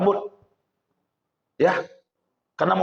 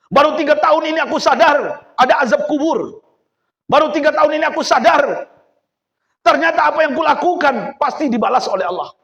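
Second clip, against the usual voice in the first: higher, louder preaching build; fervent preaching; 7 semitones higher, 5 dB louder than usual. Acted. Businessman confessing his awakening to the young man, fervent preaching. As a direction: preach it with full fire.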